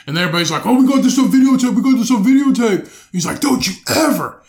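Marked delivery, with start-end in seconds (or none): 0.6-3.1 imitating bystanders, higher and louder, quick; mock the filming crowd in a put-on voice. Bystanders taunting in retold fight. 3.4-4.4 higher, loud retold rage at the guy; imitated angry yell. Angry man yelling in retold fight.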